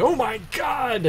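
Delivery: high, drawn-out cry of horror; mock scream. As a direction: cry out high, stretching the words. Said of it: "is screaming".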